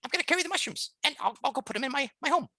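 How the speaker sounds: high, eager mouse voice; manic little mouse. Acted. Mouse answers John's worry about his body, manic. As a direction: explain the plan in an excitable squeak. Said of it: "almost manic voice, just really energetic".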